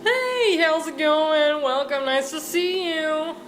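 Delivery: high, slow friendly host voice; cheery innkeeper. Acted. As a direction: greet him like an overeager innkeeper. Inn owner greets Gary.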